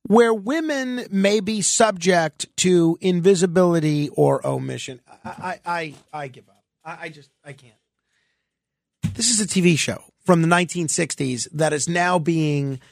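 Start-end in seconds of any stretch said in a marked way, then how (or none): 5.2-7.7 fading to almost nothing, mock defeated; exasperated sigh of surrender, barely audible. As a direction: trail off like you've given up. Mock despair over the news.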